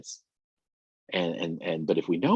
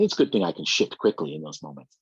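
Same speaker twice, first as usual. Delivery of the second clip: ordinary delivery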